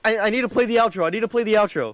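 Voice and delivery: panicking gamer. Real, not acted. Virus countdown, frantic rush.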